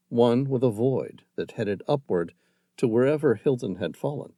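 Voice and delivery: ordinary delivery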